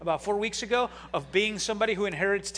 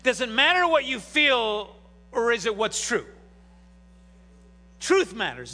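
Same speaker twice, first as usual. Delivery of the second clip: usual speaking voice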